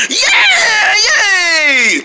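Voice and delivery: high, loud whoop of joy; excited cheer. Acted. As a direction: cheer like a happy kid. Celebrating new butler.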